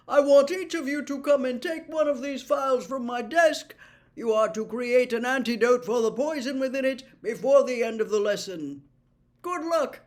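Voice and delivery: loud, high, jovial potions master; hearty professor. Acted. Slughorn setting the class a task, loud and jovial. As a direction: address the room with grand cheer.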